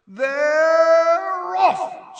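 high, loud, long-drawn starting call; Bagman's scream. A narrator's reading. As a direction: scream the start of the match, stretching the first word. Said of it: "screamed Bagman".

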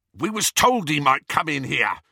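loud, high retort; rough, irritated henchman; defensive anger. A narrator's reading. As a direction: snap back like a cornered thug. Amycus answers back angrily.

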